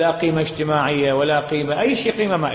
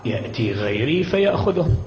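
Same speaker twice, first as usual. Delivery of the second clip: much lower, slow, callous voice; callous plunderer; 10 semitones lower than usual. Retold or imitated. Preacher voicing the plunderer answering the little girl, low and callous. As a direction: shrug it off coldly, slowly. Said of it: "قال".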